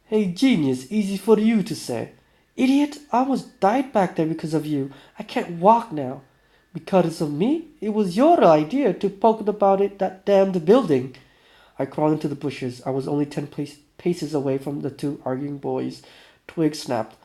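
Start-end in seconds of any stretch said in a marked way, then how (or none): 0.0-11.2 higher-pitched bickering; acted squabble with raised pitch; heated argument. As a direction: argue back indignantly. Two boys arguing, acted.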